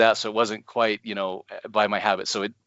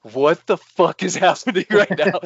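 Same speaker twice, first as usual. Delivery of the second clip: laughing disbelief; 8 semitones higher, 5 dB louder than usual. Retold or imitated. Baffled by cashier's weird question.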